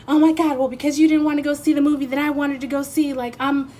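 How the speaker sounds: high, rushed, put-on indignation; hammed-up whiny complaint; mock quarrelling. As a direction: play an exasperated partner blowing up over nothing. Acting out an overblown couple's quarrel.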